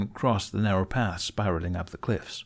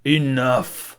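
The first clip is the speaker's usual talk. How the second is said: louder stern order; 5 semitones higher, 6 dB louder than usual. A narrator's reading. Stoic silencing his arguing council, loud order. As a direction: cut them off with a command. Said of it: "Stoic ordered".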